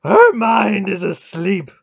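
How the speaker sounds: high, slow bellow; loud, drawn-out furious cry; howling frustration. A narrator's reading. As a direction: howl the discovery in outrage. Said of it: "he howled".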